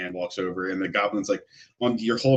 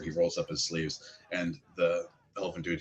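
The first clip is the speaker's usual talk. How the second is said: usual speaking voice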